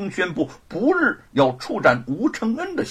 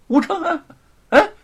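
higher, insistent call; raised-pitch hailing; 12 semitones higher, 7 dB louder than usual. Acted. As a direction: call his name to snap him out of it. Calls again, louder, to rouse him.

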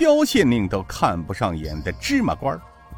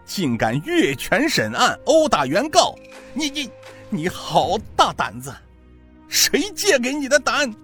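higher, outraged curses; furious ranting; 8 semitones higher than usual. A narrator's reading. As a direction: spit defiance at the judge. Beaten prisoner cursing the magistrate.

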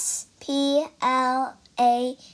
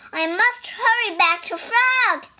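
higher, louder urgent call; crying out; 9 semitones higher, 5 dB louder than usual. Acted. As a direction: call out in a hurry. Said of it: "he cried".

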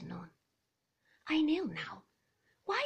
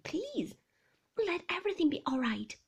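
pleading wife; 7 semitones higher, 6 dB louder than usual. A narrator's reading. Young wife pleading with Maxim, high and hurried. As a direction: hurry the plea out.

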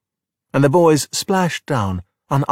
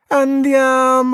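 long, high, stretched-out Andiamo; excited cry; 13 semitones higher, 4 dB louder, much slower than usual. A narrator's reading. Alberto sending the Vespa down the hill, drawn-out excited cry. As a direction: launch the ride with a slow, soaring whoop.

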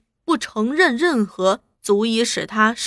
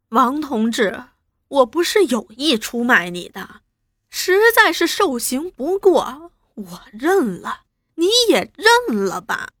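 feigned anguish; 2 semitones higher than usual. Acted. Traitor begs comrade to confess too, feigning anguish. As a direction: plead as if in unbearable pain. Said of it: "装作痛苦不堪".

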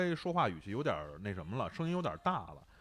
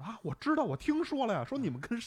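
put-on much higher Hong Kong auntie accent in Mandarin; gossipy Hong Kong auntie, pitched way up; imitating a Hong Kong auntie's accented Mandarin; 9 semitones higher, 7 dB louder than usual. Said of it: "就是香港阿姨".

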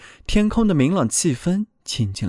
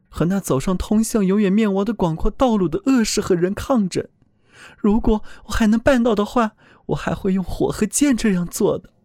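higher, earnest voice of a frightened soul; pleading soul voice; 8 semitones higher than usual. A narrator's reading. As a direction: speak up as a soul defending itself. Soul boasts to angel.

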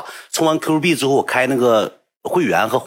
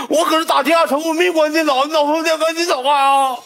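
high, insistent denial; mock protest. Boy denying to the attendant, high and pleading. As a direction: protest your innocence, voice way up.

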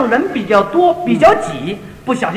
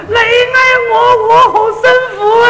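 Cantonese outburst; 11 semitones higher, 4 dB louder than usual. Retold or imitated. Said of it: "讲了一句广东话".

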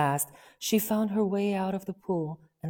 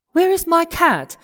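eager call; 11 semitones higher, 8 dB louder than usual. Acted. Alice addresses mouse eagerly.

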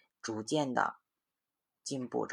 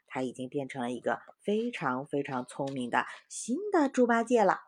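story reading; 6 semitones higher, 5 dB louder than usual. A narrator's reading. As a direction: lively voice reading to children. Mother reads story to children. (给孩子讲故事的生动语气)

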